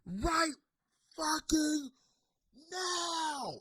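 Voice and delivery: mock scream, high and drawn out; high, strangled imitation of screaming; imitated screaming. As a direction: screech each word out slowly, held back in volume. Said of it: "like, screaming".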